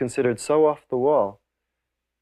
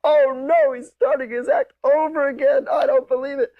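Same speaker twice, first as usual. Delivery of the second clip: very high, loud mock groaning disbelief; exasperated audience; 13 semitones higher, 3 dB louder than usual. Audience catching on to the repeated act, high mock dismay. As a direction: wail it in a high put-on voice. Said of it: "They would go".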